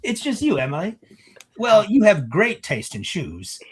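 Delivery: high-voiced, showy hostess; gracious event planner. Event planner telling Emily her friend took off, high gracious tone. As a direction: politely brush past the awkwardness, pitched high.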